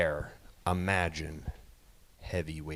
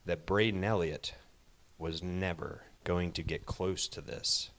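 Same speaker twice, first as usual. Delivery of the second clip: usual speaking voice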